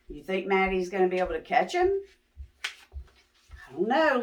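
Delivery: ordinary delivery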